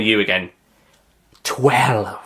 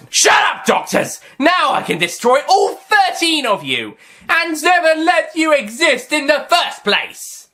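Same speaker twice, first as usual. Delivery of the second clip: gloating villain shout; 4 dB louder than usual. Acted. Master gloating threat.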